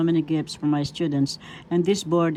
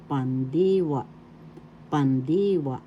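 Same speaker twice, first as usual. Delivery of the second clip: pandiwa with a long, raised DI; stress on second syllable. Shown as a demonstration. Teacher demonstrating a Tagalog word, stress on pandiwa's second syllable. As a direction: stretch the middle of pandiwa, pan-DEE-wa. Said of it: "The accent is in the second syllable".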